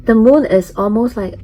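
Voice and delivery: normal talking voice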